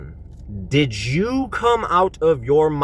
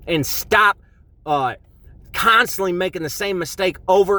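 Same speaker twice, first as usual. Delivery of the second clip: raised pitch, pressing faster as the passion builds; impassioned preaching; 7 semitones higher, 3 dB louder than usual. Acted. Preacher urging addicts to drop the label, fired-up preaching. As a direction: preach it hard, driving the point home.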